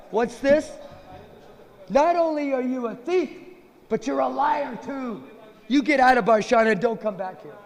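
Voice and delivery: high, scolding outrage; indignant friends banishing a thief; angry accusation. Acted. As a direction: tear into the thief and throw him out. Sakis catch and banish the thief.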